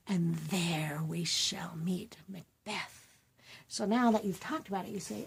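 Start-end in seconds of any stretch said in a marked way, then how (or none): 0.1-3.1 slow eerie witch whisper. Acted. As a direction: hiss the secret like a witch. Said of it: "they're talking like this".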